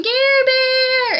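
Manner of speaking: high, loud screamed nickname; excited fan scream; screamed name. Retold or imitated. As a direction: shout "Gare Bear" across the room to get his attention. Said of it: "I scream".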